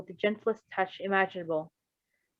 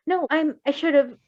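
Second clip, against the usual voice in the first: higher, flustered and halting; stuttering; 7 semitones higher, 5 dB louder than usual. Acted. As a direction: trip over the words in a fluster. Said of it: "the doctor stuttered".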